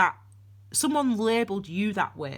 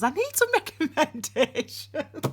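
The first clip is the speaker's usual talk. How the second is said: higher, laughing mock-desperation; playful begging that dissolves into giggles; laughing plea; 9 semitones higher than usual. Real, not acted. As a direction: break into laughter on the last word. Jokingly begging viewers, breaks into laughing.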